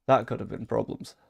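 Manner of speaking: ordinary delivery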